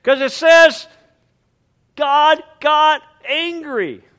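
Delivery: high, loud and slow emphatic declaration; preaching force. Real, not acted. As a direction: proclaim it with force. Preacher emphasizing God's anger.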